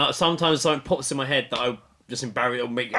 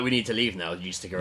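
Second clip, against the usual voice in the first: hushed; 8 semitones lower, 6 dB quieter than usual. Pretend. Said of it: "Were you like".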